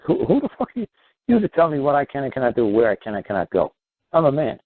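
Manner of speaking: high, indignant challenge to authority; defiant outburst. Pretend. Imagined defiant father telling off the state, indignant. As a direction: bristle at being told what to do.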